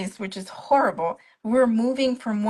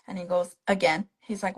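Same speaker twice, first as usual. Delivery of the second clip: normal talking voice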